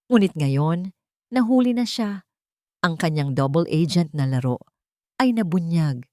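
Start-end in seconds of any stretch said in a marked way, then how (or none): none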